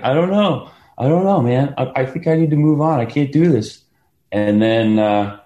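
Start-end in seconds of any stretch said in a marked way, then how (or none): 0.0-3.8 high, loud distraught whine; retold despair. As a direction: sound like you're ready to quit in tears. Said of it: "And I'm like".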